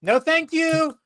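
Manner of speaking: very high, loud repeated squeal; panicked refusal. Acted. Player's character frantically pushing the watch to escape, panicked. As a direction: fend it off in a comic high-pitched panic. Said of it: "I'm like, pushing it fifteen times really fast".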